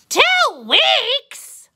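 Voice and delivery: high, loud outraged squeak; shocked disbelief. Acted. Caterpillar shrieks at how long it takes. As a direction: jump up in shock at the wait.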